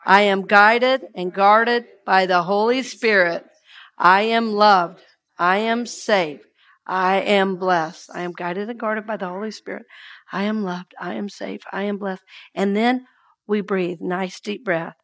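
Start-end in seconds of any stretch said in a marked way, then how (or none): 0.0-8.1 slow, deliberate recitation led for the room; steady call for the congregation to echo; slow affirmation. Shown as a demonstration. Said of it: "Say that with me one more time". 8.1-12.2 softer, near-breath repeat of the affirmation; quiet affirmation. Shown as a demonstration.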